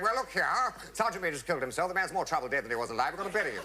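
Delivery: high, exasperated mock officer; officer addressing parade. O C to the lined-up men, exasperated officer. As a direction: play an officer briskly laying down the situation. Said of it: "the O C lined us up and said".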